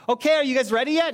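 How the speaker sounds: playful impatient call to the family; impatient nagging. Retold or imitated. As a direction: hurry everyone up, mock exasperated. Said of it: "And then it's like".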